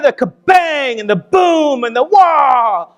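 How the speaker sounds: loud, drawn-out bomb noises, rising in pitch; imitated explosions. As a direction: boom it out like falling bombs. Imitates bombs exploding nearby.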